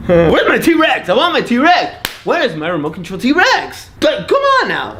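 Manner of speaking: high-pitched mock whining demand; acted child's tantrum. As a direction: play a kid demanding the toy. Child's tantrum over missing present.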